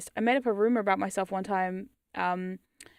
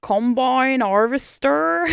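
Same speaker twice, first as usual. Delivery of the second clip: put-on louder, drawn-out rural English accent; hometown UK accent; 4 semitones higher, 7 dB louder, much slower than usual. Streamer shows the accent they escaped, broad country English accent. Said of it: "I would have sounded like".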